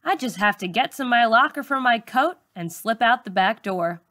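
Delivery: ordinary delivery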